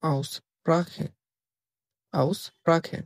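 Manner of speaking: Aussprache said slowly with German sounds; German pronunciation demo. Shown as a demonstration. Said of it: "প্রনাউন্সিয়েশনকে বলে". (Aussprache শব্দটি ধীরে German উচ্চারণে)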